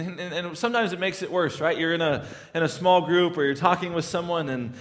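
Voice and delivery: ordinary talk, nothing marked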